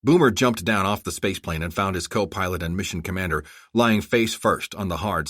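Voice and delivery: ordinary delivery, nothing marked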